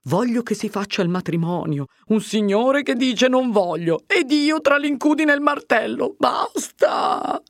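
rising, exasperated self-pitying rant; agitated fretting. A narrator's reading. Curate alone dreads the whole mess renewed, exasperated.

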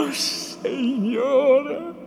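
very slow, high, pained cry; anguished, drawn-out woman's lament; sorrowful exclamation. A narrator's reading. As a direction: wail it out to heaven. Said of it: "esclamò dolorosamente Lucia".

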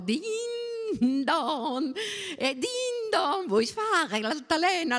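high, swinging sing-song; sing-song child play. A narrator's reading. Bona rocks the little girl back and forth, playful sing-song. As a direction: chime it high like a bell for a toddler.

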